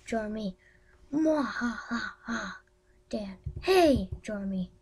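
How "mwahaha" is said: slow evil villain cackle; mad scientist laugh. Pretend.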